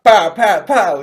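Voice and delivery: loud, punchy "pow" sound effects; rapid-fire mock impact noises; imitated blows. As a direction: voice each stab as a loud burst.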